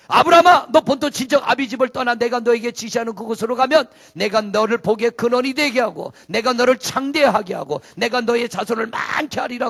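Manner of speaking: loud, booming divine call; God calling out. Acted. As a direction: call Abraham from heaven, commanding. God calling Abraham in sermon. (하나님이 아브라함을 크게 부르듯)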